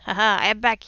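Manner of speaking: ordinary talk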